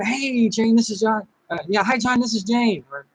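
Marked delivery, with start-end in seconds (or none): 0.0-1.3 higher, upbeat hello on the phone; energetic phone greeting. Shown as a demonstration. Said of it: "if I'm standing up, you know, I'm moving". 1.8-2.8 higher, bright hello on the phone; energetic phone greeting. Shown as a demonstration. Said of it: "put emotion into your voice".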